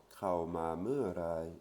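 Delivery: "Kraoma me rai" said slowly and deliberately; slow Thai demo. Shown as a demonstration. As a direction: model "Kraoma me rai" syllable by syllable. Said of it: "il vient quand?".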